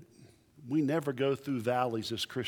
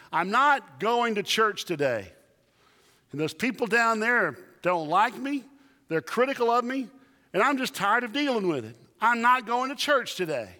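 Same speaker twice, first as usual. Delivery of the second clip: higher, louder grumbling refusal; whiny pastor; 7 semitones higher, 4 dB louder than usual. Retold or imitated. Pastor under the covers refusing his wife, whiny. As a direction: complain like a sulking man under the covers. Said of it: "he yells out to his wife".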